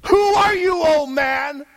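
loud, high, driving sermon voice; preaching at full force. Acted. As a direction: preach it hard, rising to a shout.

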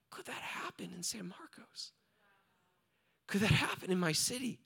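hushed, near-whispered plea; tearful prayer. Retold or imitated. Preacher recalling his prayer for San Marcos, hushed and moved. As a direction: ask it softly, as if moved to tears. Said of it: "start to weep and say".

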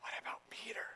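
very soft audience whisper. Acted. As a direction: whisper the nagging question from the audience. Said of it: "the first century audience would whisper".

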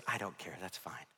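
tired wife. Retold or imitated. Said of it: "she says".

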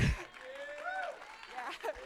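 slow, high laughing yeah; delighted laugh over yeah. Real, not acted. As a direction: let the laugh carry the word. Laughing at the healing.

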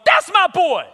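high, loud yell of support; whooping, loud hype for a buddy; proud cheering shout. Retold or imitated. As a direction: holler it like cheering a friend on stage. Acting out cheering on his friend.

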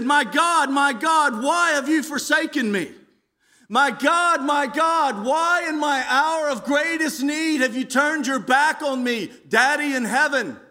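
high, loud cry to God; anguished cry. Acted. Jesus on the cross to his Father, anguished high cry. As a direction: cry out in desperate appeal, pitch way up. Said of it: "Jesus cried out towards the end".

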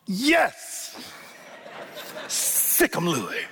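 a sudden high "Yes" that drops to a hushed, laughing "sick 'em"; egging on a friend on the radio, high then hushed with a laugh; excited cheering. Retold or imitated. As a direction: cheer the speaker on from your armchair. Cheering on broadcast from recliner.

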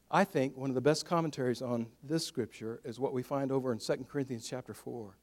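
ordinary delivery

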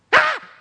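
sudden loud, high-pitched scream; acted shriek of amazement; widow's shriek. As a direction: scream out at the sight. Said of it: "her response is this".

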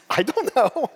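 sheepish laugh through the answer; laughing it off, caught out. Retold or imitated. Retelling dad's gas tank question.

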